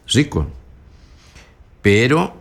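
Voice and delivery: normal talking voice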